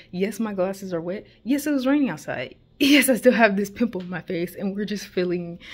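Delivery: ordinary talk, nothing marked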